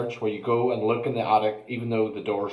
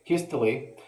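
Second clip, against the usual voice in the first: ordinary delivery, nothing marked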